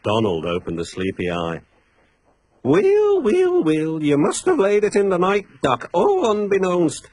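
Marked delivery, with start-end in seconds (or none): 2.6-7.1 high, loud teasing Scots voice; gloating Scottish engine; Scottish accent. Acted. Donald teases Duck, Scottish voice.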